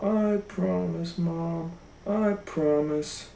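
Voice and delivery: put-on high, soft, slow sweet little-girl voice; little girl promising. A narrator's reading. Goldilocks promising her mom, high sweet and soft. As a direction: promise sweetly like an innocent child. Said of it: "Goldilocks said".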